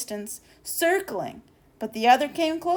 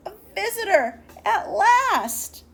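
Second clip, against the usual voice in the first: higher, rising to a glad cry; excited; 10 semitones higher, 5 dB louder than usual. A narrator's reading. Narrator sharing the moon's joy, higher and excited. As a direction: burst out with joy at last. Moon finally gets a visitor.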